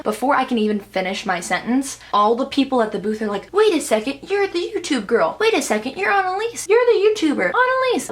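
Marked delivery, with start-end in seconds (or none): 3.5-8.1 high, excited squealing recognition; freaking out. Retold or imitated. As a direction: imitate fans losing it over a YouTuber. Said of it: "Like, freaking out".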